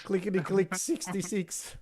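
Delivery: high, loud bingo call; bingo caller. Pretend. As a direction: sing out the number like a bingo caller. Calling bingo after streaming bingo joke.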